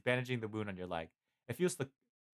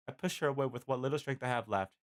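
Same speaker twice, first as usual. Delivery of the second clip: usual speaking voice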